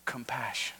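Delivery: breathed softly, almost whispered; hushed. Shown as a demonstration. Preacher inviting the congregation to answer, hushed. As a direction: let the word fall to a hush. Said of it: "say it out loud with me".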